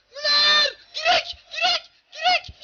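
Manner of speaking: high, loud repeated yells of disbelief; disbelieving shout. Real, not acted. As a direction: yell at the screen as it hits the post. Shot hits the post. (yüksek sesle isyan eden bağırış)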